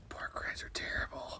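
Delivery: whispered like a crackly radio. Pretend. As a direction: murmur it as if over a walkie-talkie. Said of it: "why is he saying that in a radio".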